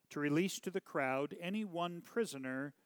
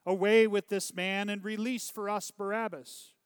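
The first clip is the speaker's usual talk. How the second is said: raised, higher outcry; crowd crying out; 6 semitones higher, 7 dB louder than usual. A narrator's reading. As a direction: cry out as an angry crowd. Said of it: "they cried out all together".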